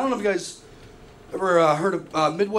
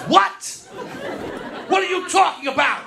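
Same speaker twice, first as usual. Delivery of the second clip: shocked mom; 8 semitones higher than usual. Retold or imitated. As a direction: squawk it in disbelief. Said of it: "She goes".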